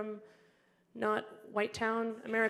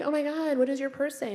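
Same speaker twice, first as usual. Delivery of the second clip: curious stranger; 8 semitones higher, 3 dB louder than usual. Retold or imitated. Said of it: "People are like".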